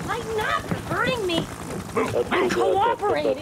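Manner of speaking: high, loud frightened protest; panicked pleading. Acted. Captured woman cries out to the soldiers in panic. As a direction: plead you're giving up.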